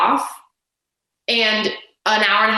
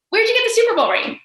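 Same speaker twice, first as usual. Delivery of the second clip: pitched up high, innocently pushy; naive insistent question; 12 semitones higher, faster than usual. Retold or imitated. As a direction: ask it a third time, higher and more insistent. Steph pressing a third time.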